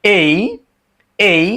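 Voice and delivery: "এই" as a long e gliding into i; correct letter A. Shown as a demonstration. Teacher repeating the letter for learners, careful pronunciation. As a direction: model "এই" slowly with a clear closing i. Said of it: "এটা হচ্ছে এই".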